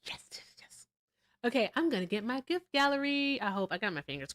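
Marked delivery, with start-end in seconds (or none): 0.0-0.9 a hushed little victory whisper; whispered yes. Real, not acted. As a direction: whisper it to yourself, quietly thrilled. Quiet private celebration.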